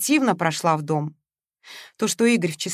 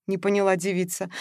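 plain reading voice

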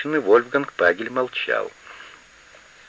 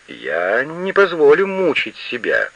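slow, high, indignant outcry; crying out; 6 semitones higher, slower than usual. A narrator's reading. Old woman refusing to be tortured, crying out indignantly. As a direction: protest in a high, drawn-out cry. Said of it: "крикнула она".

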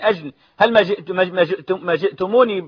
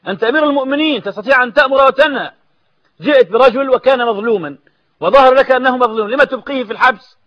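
raised, higher preacher's rebuke to the caliph; preaching at full force; 6 semitones higher, 3 dB louder than usual. Acted. Preacher confronting the caliph over the prisoner, raised rebuke. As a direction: thunder the reproach from the pulpit.

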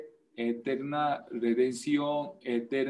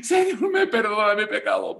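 hammed-up crying plea, much higher and louder; mock wailing prayer; 7 semitones higher, 4 dB louder than usual. Preacher mimicking worshippers crying for pardon, mock wail. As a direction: wail for forgiveness like a parody. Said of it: "gritando, bailando, llorando".

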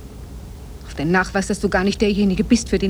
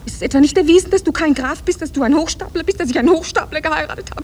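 outraged accusation; 8 semitones higher than usual. Acted. Wife accuses husband of being a fraud, outraged. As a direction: fling each charge at him.

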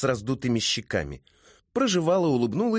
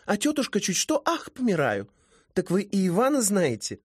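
put-on high, lively girlish character voice; high-voiced, sociable young mermaid; chatty young woman; 8 semitones higher than usual. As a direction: play a chatty girl, pitched up.

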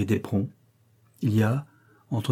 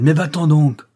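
higher, louder, punching "Mais" hard; furious shout; 5 semitones higher, 7 dB louder than usual. Acted. Indian girl drives the man away with her knife, furious shout. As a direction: yell "Mais va-t'en donc" full force. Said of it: "lui crie-t-elle".

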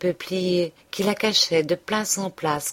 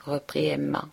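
usual speaking voice

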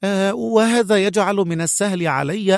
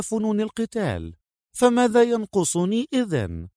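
high female voice, mildly defiant; spirited but controlled challenge; challenging; 6 semitones higher, 4 dB quieter than usual. A narrator's reading. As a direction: push back at his condescension, pitched high. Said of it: "قالت منى بلهجة تحد".

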